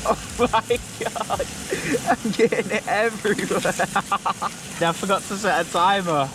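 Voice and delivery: high startled squeal; panicked squeal. Real, not acted. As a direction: caught off guard by spitting fat. Fat spitting everywhere.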